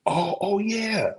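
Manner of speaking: high, slow drawn-out oh of understanding; mock dawning realization. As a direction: let it slowly dawn on them, way up high. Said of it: "they say".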